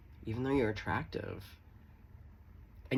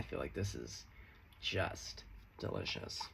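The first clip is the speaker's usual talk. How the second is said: breathy whispered savoring; 8 semitones lower, 8 dB quieter than usual. Real, not acted. Reader savoring her cards, whispered. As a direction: whisper it like tasting something delicious.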